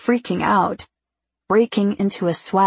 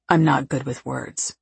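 low, subdued male character voice; reserved Thomas; 4 semitones lower than usual. A narrator's reading. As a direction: lightly drop into a reserved man's voice.

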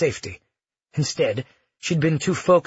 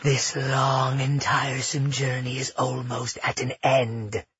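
inner water spirit. A narrator's reading. Water kindred speaks inside Cleo, even and otherworldly. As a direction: speak evenly as a spirit within. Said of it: "the water kindred said from within her".

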